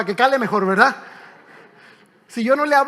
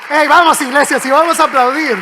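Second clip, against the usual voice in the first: rallying call; 2 semitones higher, 9 dB louder than usual. Real, not acted. Calling congregation to applaud.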